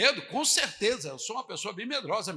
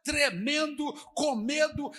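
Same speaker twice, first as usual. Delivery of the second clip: ordinary delivery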